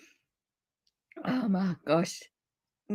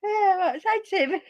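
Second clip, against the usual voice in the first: giggling; 9 semitones higher, faster than usual. Real, not acted. Giggling while telling story.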